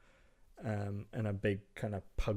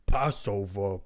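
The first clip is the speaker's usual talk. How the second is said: a lightly voiced troll, a bit louder; gruff giant troll, broken words; 5 dB louder, slower than usual. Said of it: "he kinda looks up at you and he says".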